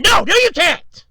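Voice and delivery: very high, loud mock yell; hammed-up screech of an upset mother; pissed-off mom. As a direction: imitate mom shouting a frantic refusal. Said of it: "she was a little pissed".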